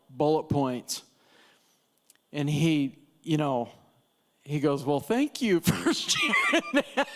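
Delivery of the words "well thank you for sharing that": high, laughing, mock-polite thanks; laughing polite brush-off. Golfer laughing off the snack girl's rant, mock-polite. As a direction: laugh through a hasty thank-you to escape.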